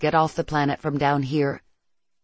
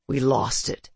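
low, dejected murmur of defeat; 4 semitones lower than usual. A narrator's reading. Claris after the ship sinks into the water, dejected. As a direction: let it sink, dejected. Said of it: "His voice sounds dejected".

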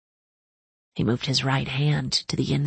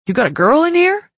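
high, loud teasing; loud mocking guy voice; teasing cousin; 11 semitones higher, 7 dB louder than usual.

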